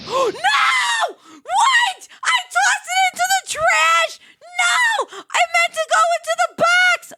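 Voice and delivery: high, loud horrified shouting; shrieking no at a blunder; panicked shout. Real, not acted. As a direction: scream in real panic. Realizes pizza went in trash.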